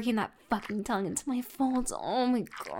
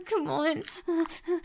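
usual speaking voice

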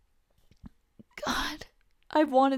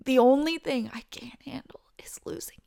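unvoiced, intimate whisper; whispered confession; 3 semitones higher than usual. Friend admitting her deepest fear, whispered. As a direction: whisper the fear of losing him. Tender plea.

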